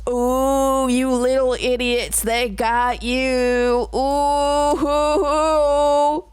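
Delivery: drawn-out, high crooning taunt; mocking wail. Real, not acted. Streamer teases viewers for falling for it, mocking wail. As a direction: stretch it into a long mock-pitying howl.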